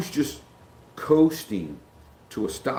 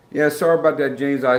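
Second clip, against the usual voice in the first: usual speaking voice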